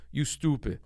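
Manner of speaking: deadpan mock put-down in a Turkish accent; father's Turkish-accented brush-off. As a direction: imitate a dismissive Turkish dad. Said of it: "In his Tur-, deep Turkish accent".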